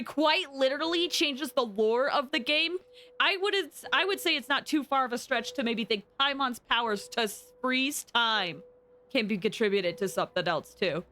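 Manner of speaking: ordinary talk, nothing marked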